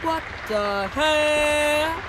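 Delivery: slow, loud, rising what the hell; drawn-out disbelief. Real, not acted. Gamer seeing the fake beach, slow shocked yell. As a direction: stretch it out in shock.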